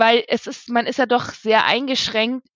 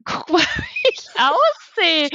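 high, slow, crying squeak; laugh-crying, voice cracking high; teary squeak; 11 semitones higher, slower than usual. Real, not acted. Showing her tear-stained face.

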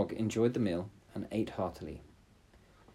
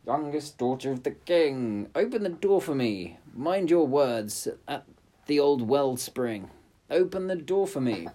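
frog calling; 5 semitones higher, 5 dB louder than usual. Acted. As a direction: call through the door. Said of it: "the frog knocked and said".

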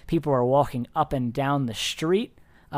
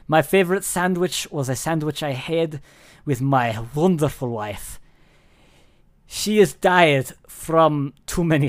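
louder, mournful mock Russian accent in broken English; sorrowful Russian shopkeeper; 5 dB louder than usual. Deli owner mourning his wife to a customer, hammed-up Russian sorrow. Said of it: "shakes his head sadly and goes".

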